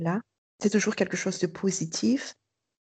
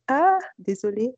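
imitating a surprised apology, higher and louder; 6 semitones higher, 5 dB louder than usual. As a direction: quote a startled "oh, sorry", pitch jumping up. Locuteurs surpris de leur méprise, cités. (excuse surprise, imitée)

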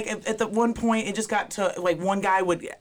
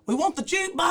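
mock yelling; 10 semitones higher than usual. Drunk bar patrons demanding music, mock yelling. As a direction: chant it like an imitated rowdy crowd. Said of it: "they're yelling back and forth".

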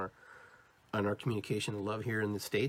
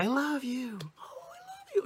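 very high, breathless demo gush; cooing over babies; 14 semitones higher than usual. Shown as a demonstration. Showing how people talk to little babies, cooing. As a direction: squeal it tiny and high, as to a baby. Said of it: "when you see little babies".

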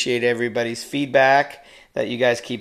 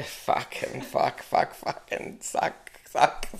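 frustrated whispered cursing; 8 dB quieter, much slower than usual. Real, not acted. Cursing a flubbed take.

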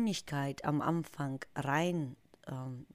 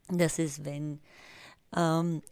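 ordinary talk

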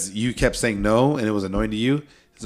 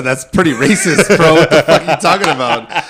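loud, laughing call-out; loud laughing accusation; laughing disbelief; 5 semitones higher, 8 dB louder than usual. Real, not acted. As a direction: laugh while calling him out. Calling out friend, laughing.

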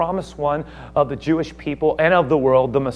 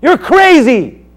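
high, slow, furious shouting; outraged shout; 12 dB louder, much slower than usual. Acted. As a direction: bellow it like a scandalised governor. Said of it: "He goes".

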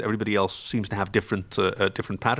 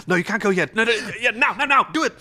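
stage manager; 9 semitones higher, 3 dB louder, much faster than usual. Retold or imitated. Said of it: "You're like your own stage manager".

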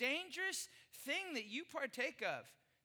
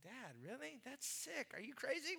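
put-on much softer, incredulous kid; disgusted kid; 11 dB quieter than usual. Son to dad about the fingers joke, muttered.

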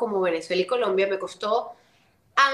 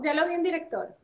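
high, softer imitation of the producer; imitated producer; 6 semitones higher, 3 dB quieter than usual. Producer reporting back to the actress, imitated high and lighter. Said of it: "pero así, ¿no?".

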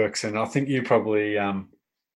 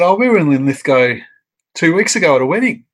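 surprised couple; 6 semitones higher, 7 dB louder than usual. Retold or imitated. Scottish couple surprised by coincidence.

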